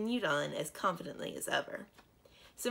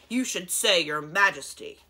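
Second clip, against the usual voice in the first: louder stern correction; warning; 9 dB louder than usual. Acted. Model dragon correcting the princess, stern warning. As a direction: scold the girl about her manners. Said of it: "with a warning scowl".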